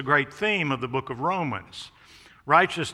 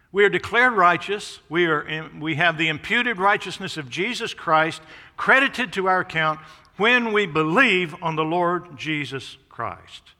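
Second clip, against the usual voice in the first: louder, higher preacher voice building on each phrase; rising preaching; 4 semitones higher, 5 dB louder than usual. Acted. Preacher to congregation, louder and higher. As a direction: proclaim it to the whole church.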